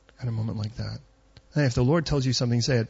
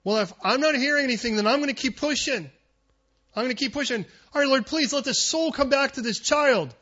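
determined plea; 11 semitones higher than usual. Retold or imitated. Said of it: "He's like".

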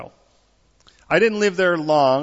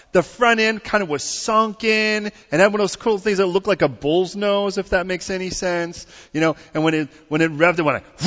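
ordinary delivery

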